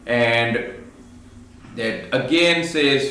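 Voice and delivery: normal talking voice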